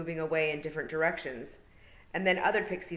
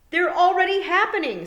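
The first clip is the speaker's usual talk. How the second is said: much higher, louder retort; exasperated outcry; 11 dB louder than usual. A narrator's reading. Tiffany answering the frightened boy, loud outcry. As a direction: throw it back at him.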